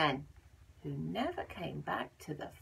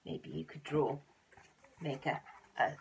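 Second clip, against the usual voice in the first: ordinary delivery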